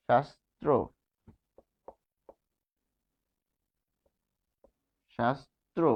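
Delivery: শাস্ত্র said softly with a lifting end; soft demo word. Shown as a demonstration. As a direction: model শাস্ত্র quietly for the class. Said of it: "তাহলে এখানে আমরা পাচ্ছি".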